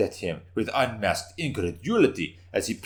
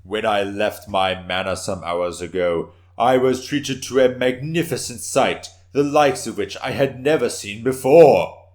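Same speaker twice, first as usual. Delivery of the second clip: bold boast; 3 semitones higher, 7 dB louder than usual. Acted. Warrior boasting to the council.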